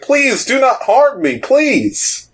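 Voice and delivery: very high, wailing plea; pleading king. Acted. Evil king begs the adventurers for mercy, pleading. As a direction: beg in a high, cowering voice. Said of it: "and he's like".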